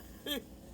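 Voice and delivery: airless, wheezing laughter; high unvoiced giggle; breathy laugh. Real, not acted. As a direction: laugh silently till it hurts. Laughing at the story.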